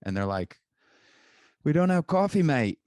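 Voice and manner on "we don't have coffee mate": put-on high, loud Australian accent; mock Australian barista. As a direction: blurt it out as an exaggerated Aussie barista. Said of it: "I don't know, I'm Australian".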